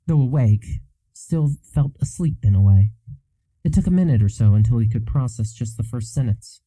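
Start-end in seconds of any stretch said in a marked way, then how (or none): none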